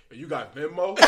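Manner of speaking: low, hushed imitation of a sick friend; quiet sheepish quote. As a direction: ask it softly and low, mimicking him. Retells sick friend's feeble question.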